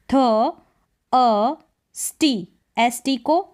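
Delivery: usual speaking voice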